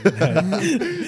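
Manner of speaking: loud protest through laughter; real laughing. Real, not acted. Guest rejects the big toe pickup line, laughing. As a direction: laugh while turning it down.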